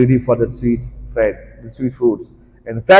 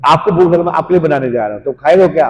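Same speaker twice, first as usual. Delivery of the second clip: raised, louder insistence; pushy offer; 4 semitones higher, 4 dB louder, faster than usual. Acted. Devotee pushing food on someone, insistent. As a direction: press the food on him.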